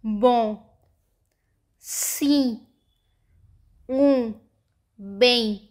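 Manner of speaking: बों, सी, ऊ, बें with the vowels sent through the nose; isolated words बों, सी, ऊ, बें, each ending in a nasal vowel; nasal sounds. Shown as a demonstration. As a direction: say बों, सी, ऊ, बें with the mouth slightly closed, humming out the nose. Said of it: "sound अपनी नाक से निकालते".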